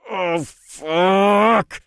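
loud, high yell through gritted teeth; pained growl. Acted. Potion drinker as wings break out of his back, pained growl. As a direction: curse through searing pain. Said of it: "I growled through gritted teeth".

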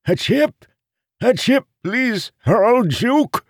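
high, loud, wheedling beggar voice; confused old beggars. A narrator's reading. Beggars confused and begging Marin for coins. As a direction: flatter and beg for the chip.

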